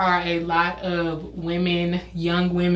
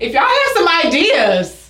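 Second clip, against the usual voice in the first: calling out to listeners; 8 semitones higher, 3 dB louder than usual. Real, not acted. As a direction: pitch it up and stretch it to the audience. Calls out to audience.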